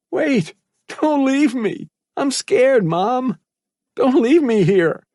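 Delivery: put-on high, slow, desperate boyish cries; shouting plea. A narrator's reading. Terrified boy shouts after his departing mother. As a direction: cry out as she slips away. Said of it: "Malcolm shouted".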